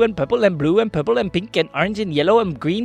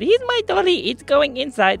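put-on high-pitched little girl at play; little girl's voice; 8 semitones higher than usual. Aubrey playing with her dolly, high girlish voice. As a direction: lift it high like a child.

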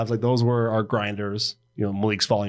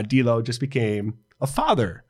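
ordinary talk

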